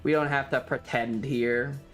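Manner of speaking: normal talking voice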